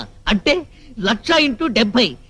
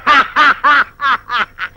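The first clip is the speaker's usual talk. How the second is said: long staccato ha-ha laugh; mocking laughter. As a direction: laugh off a silly claim. Laughs at the ladies claim. (ఎగతాళి నవ్వు)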